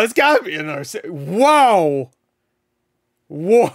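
ordinary delivery

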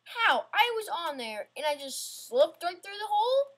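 loud, high outraged question; real frustrated yell; outraged disbelief. Real, not acted. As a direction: blow up at the game. Slips through the floor.

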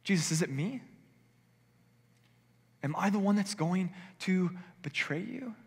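a disciple afraid it is him; fearful plea. Acted. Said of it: "looks to Jesus and says".

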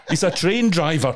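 high, laughing mock reading; mock script line. Actor reading a script aloud, laughing mock line. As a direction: giggle through a silly script line.

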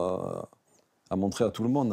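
ordinary delivery

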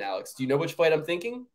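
normal talking voice

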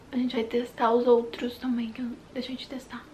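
whispering like an ASMR artist. Shown as a demonstration. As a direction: slip into ASMR mode and whisper. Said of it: "eu tô viciada em A S M R".

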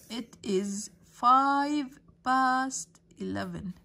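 slow dictation. Shown as a demonstration. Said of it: "teacher models answer".

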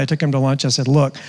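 ordinary talk

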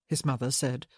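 ordinary delivery, nothing marked

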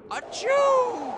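big high sneeze; loud sneeze. Acted. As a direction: let out a big cartoon sneeze. Sneezing from dandelion seeds.

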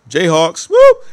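loud high whoop; cheer. Real, not acted. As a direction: shout the team cheer. School cheer.

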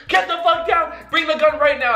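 high, frantic mock shout; imitated yelling. Imitating Brandon ordering him downstairs, mock yelling.

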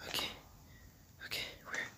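hushed whisper while hiding; scared whisper in hiding. Real, not acted. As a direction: breathe it so the monster won't hear.

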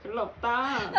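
normal talking voice

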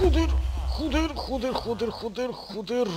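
high frantic repeated swearing; panicking, yelling joder over and over; panicked cursing. Real, not acted.

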